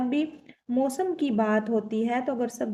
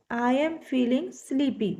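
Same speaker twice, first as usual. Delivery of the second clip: slow dictation; much slower than usual. Shown as a demonstration. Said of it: "नींद आ रही है".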